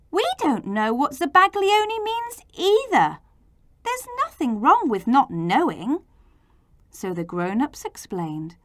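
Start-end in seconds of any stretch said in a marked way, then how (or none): 0.1-6.0 high-pitched protest, easing down; crying out. A narrator's reading. Children defending I's ignorance, high-pitched protest. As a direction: protest high, without much volume. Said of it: "the children all cried".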